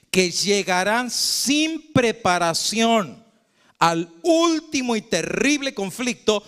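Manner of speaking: slow solemn recitation; solemn reading. Acted. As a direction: read it out like a grave prophecy. Reads from the book Conflicto.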